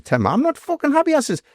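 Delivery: retold complaint, pitched well up; indignant protest. Doorman retelling his objection to Viv's pay, indignant. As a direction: protest hotly in a high, aggrieved voice.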